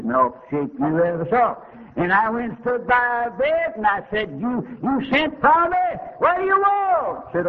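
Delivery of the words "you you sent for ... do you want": higher and higher, a challenging demand; rising pitch, daring her to answer; indignant retort. Retold or imitated. Preacher retells his reply to her.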